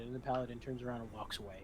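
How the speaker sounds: ordinary delivery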